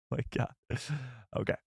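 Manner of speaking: usual speaking voice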